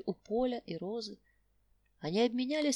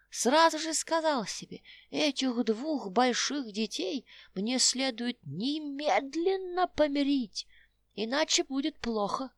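put-on old tramp voice; 3 semitones higher, 4 dB louder than usual. Old tramp talks to quarrelling couple.

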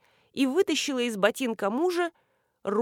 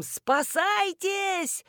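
wailing cry; 10 semitones higher, much slower than usual. A narrator's reading. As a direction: stretch out a high cry of alarm. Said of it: "и с воплем".

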